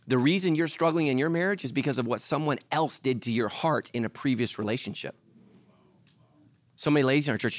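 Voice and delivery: ordinary talk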